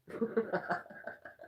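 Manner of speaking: soft, high chuckling run; laugh. Real, not acted. Streamer cracking up over a booger, soft high laugh. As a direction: laugh quietly at your own joke. Cracking up at her own joke.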